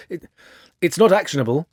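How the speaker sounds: stammering. Acted. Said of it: "he stammered".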